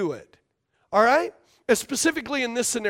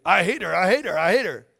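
repeated grumbling; 6 semitones lower than usual. Retold or imitated. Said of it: "you started saying it".

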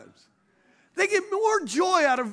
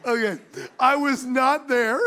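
laughing denial; 2 semitones higher than usual. Real, not acted. Preacher denying to congregation he visited the place, laughing. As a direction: insist on it while cracking up. Laughing at own accidental innuendo.

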